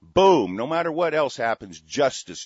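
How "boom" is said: loud imitated gunshot; a short, punchy spoken gunshot. Host acts out the shot again with a loud boom.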